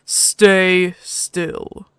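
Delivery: a hint of a gruff alien dog-man; slow, heavy foreign-sounding voice; gruff alien husky. The husky speaking to the stranger, lightly gruff.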